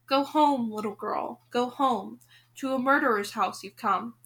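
plain reading voice

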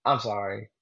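flat, quiet, uncaring mumble of sorry; imitating a bored, indifferent sorry; apathetic. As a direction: toss off an apology you don't mean. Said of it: "he just sounded so apathetic about it".

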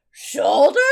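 loud, hopeful acted question; loud questioning exclamation. Friend asks the German butcher for pork shoulder, loud questioning. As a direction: blurt it loudly as a question. Acting out shopper asking butcher.

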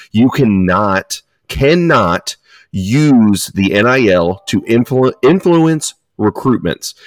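slow, deliberate, word by word; slow emphatic. Real, not acted. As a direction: spell it out slowly. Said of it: "slow emphatic explanation".

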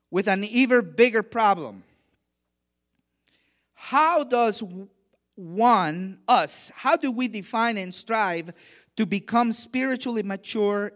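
rising sermon voice, pushing the key lines out loud; preaching. Acted. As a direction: preach it to the congregation with conviction. Preaches to the congregation.

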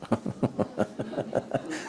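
quiet amused laugh under the breath; hushed chuckle; soft breathy laugh. Real, not acted. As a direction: chuckle at his own paraphrase. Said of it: "eso básicamente es lo que están diciendo".

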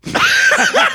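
shrieking laugh, loud; high burst of laughter. Real, not acted. Reaction to joke.

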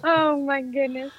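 amused, giggly self-mockery; laughing. Real, not acted. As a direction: talk while recovering from a real laugh. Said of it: "obnoxious laugh".

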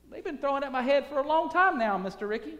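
put-on young player answering the manager, wry and quick; Jackie's wry reply. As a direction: toss it back quick and wry. Preacher voices Jackie's reply.

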